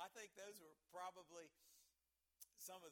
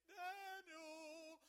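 high sung wail of the name, louder; lamentable cry; 9 semitones higher, 4 dB louder than usual. Retold or imitated. A frightened king calls down into the den, wailing. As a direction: call the name out in a mournful, singing wail. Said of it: "he cried with a lamentable voice".

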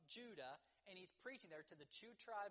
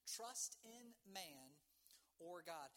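"Trust in Man" drawn out, pause before "or God"; deliberate, spaced-out question on "Trust in Man or God"; slow, weighty repeat; much slower than usual. Real, not acted. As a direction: stretch each word of "Trust in Man", then set "or God" apart. Title repeated slowly as a question.

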